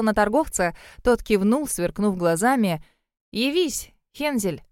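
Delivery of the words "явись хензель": tentative summons, lifted in pitch; hesitant, testing the words; unsure. A narrator's reading. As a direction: call it out without conviction. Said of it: "Неуверенно обратилась она".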